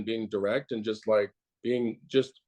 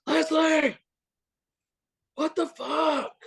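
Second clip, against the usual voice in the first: yelling across office; slower than usual. Retold or imitated. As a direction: holler it high over the whole room like a boss calling out. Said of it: "I'm just like".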